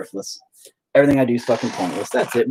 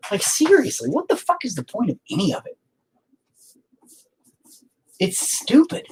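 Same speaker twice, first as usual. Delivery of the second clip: exasperated rant; 11 semitones higher than usual. Real, not acted. Venting despair, voice pitched up.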